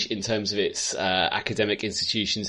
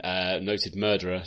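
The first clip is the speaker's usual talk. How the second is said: ordinary delivery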